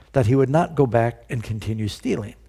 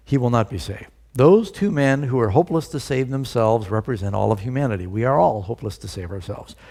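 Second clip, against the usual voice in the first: ordinary talk, nothing marked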